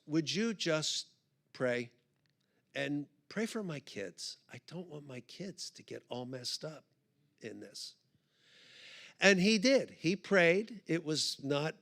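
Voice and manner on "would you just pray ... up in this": low, very soft, halting request; hushed retold plea, trailing down; emotional plea. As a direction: ask quietly, near tears. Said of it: "I was so emotional at that time".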